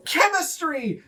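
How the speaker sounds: high, proud one-word blurt; high eager gnome. Acted. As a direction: pipe it up proudly. Gnome names his science.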